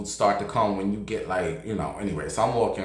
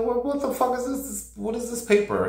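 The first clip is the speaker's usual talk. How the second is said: high squeal of shock; what the hell is this, pitched way up; shocked disbelief; 13 semitones higher than usual. Retold or imitated. Shocked at stranger's paper.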